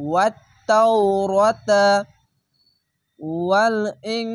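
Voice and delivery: slow, drawn-out chanted recitation; Quran recitation. Shown as a demonstration. Teacher to students, slow drawn recitation. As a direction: recite it slowly with tajweed. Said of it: "Quran recitation demo".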